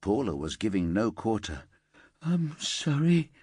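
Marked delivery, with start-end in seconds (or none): 2.2-3.3 slow, high, mild whimper; a small broken apology. Acted. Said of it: "she whimpered".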